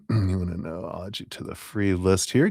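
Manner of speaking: normal talking voice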